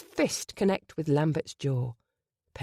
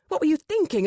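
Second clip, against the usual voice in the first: sharp rebuke; 10 semitones higher, 5 dB louder, faster than usual. A narrator's reading. Brother scolds Penny.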